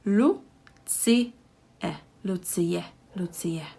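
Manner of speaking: Lucie said as Lu-ci-e, three clear syllables. Shown as a demonstration. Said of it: "we pronounce it as three syllables".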